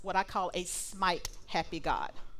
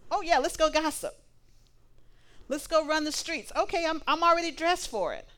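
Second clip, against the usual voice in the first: higher, louder, eager yes; eager agreement; 6 semitones higher, 4 dB louder than usual. Acted. Old-rags self happily agrees to go gossip, eager. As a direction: jump at the chance like it's fun.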